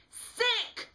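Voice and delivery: very high, loud disgusted scream. A narrator's reading. Alice screaming at Smitty's mooning in disgust. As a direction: shriek it in revulsion. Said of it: "screams Alice".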